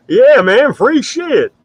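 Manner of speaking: hoog en luid geïmiteerd, een lompe fan; mock dumb supporter. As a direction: doe een domme, opgewonden fan na. Mocking the Bernie supporter.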